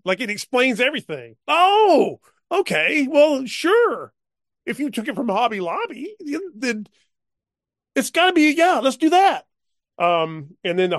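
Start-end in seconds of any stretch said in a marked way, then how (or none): 1.5-9.5 high, loud, sing-song mock acceptance; mock credulous. Player mocking the Hobby Lobby excuse, high, loud and over-eager. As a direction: play along in an over-the-top high voice as if it all makes sense.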